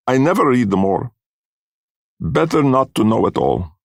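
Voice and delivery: high-pitched parting words in broken English; resigned foreign fortune-teller. A narrator's reading. Missus Grummick giving up reading at the door, resigned. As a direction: swear off it in a high foreign woman's voice.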